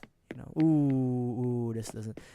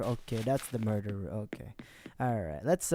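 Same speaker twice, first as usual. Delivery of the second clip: ordinary talk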